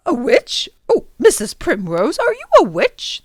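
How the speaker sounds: mock-asking the housekeeper; playful high old gentleman; teasing professor.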